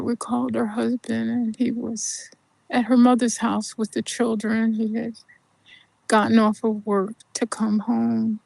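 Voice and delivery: ordinary talk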